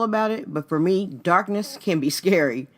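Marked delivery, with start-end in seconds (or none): none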